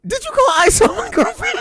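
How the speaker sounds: high-pitched incredulous accusation; incredulous question. Acted. Brother confronts Maya about calling ice, incredulous. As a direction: ask it with mock disbelief, voice shooting up.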